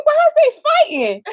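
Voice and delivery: very high, amused disbelief; acted bewilderment. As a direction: ask it in squeaky mock alarm. Woken by an argument, retold.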